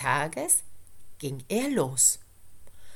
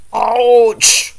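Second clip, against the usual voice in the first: loud bear grumble; a big bear grumbling in pain; 6 semitones higher, 17 dB louder than usual. A narrator's reading. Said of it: "brummte der Bär".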